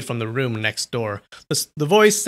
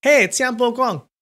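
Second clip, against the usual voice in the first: higher and louder call; yelling; 10 semitones higher, 4 dB louder than usual. A narrator's reading. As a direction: yell to him next door. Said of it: "Chu Feiyan yelled".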